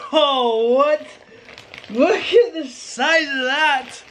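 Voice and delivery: high, drawn-out amazement; real excitement. Real, not acted. Showing viewers the huge new turbo, excited. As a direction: gush in high-pitched disbelief. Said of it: "sorry, I'm getting excited here".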